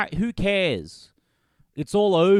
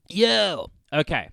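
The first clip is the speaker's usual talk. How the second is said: usual speaking voice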